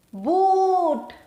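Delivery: Boot with a long oo; model word. Shown as a demonstration. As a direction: say Boot for the class to repeat. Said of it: "Speak after me".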